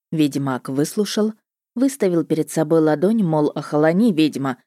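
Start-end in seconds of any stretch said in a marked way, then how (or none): none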